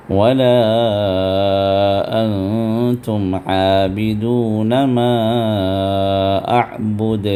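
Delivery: slow chanted tajweed recitation. Shown as a demonstration. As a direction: recite slowly and evenly. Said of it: "تو پڑھیے".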